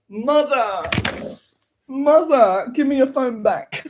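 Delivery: high, loud whine for the phone; whining son. Pretend. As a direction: beg mother for the phone back.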